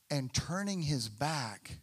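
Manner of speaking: usual speaking voice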